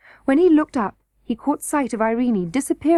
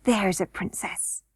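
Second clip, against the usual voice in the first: slow, soft, hushed praise; just above a whisper, pleased; delighted whisper; 5 dB quieter, slower than usual. A narrator's reading. Curdie delighted with the princess, barely above a whisper. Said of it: "still in little more than a whisper".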